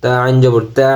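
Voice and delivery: ordinary delivery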